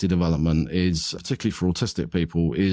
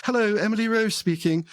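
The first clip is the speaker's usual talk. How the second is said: much higher, prim and proper phone greeting; grandma's pitched-up posh telephone greeting; 13 semitones higher than usual. Retold or imitated. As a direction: put on a polished telephone manner, pitched way up. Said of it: "My grandma had a kind of telephone voice".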